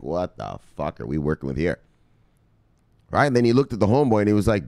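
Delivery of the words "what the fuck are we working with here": exasperated king aside. Retold or imitated. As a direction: lower and softer, muttered in disbelief. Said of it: "Leonidas was like".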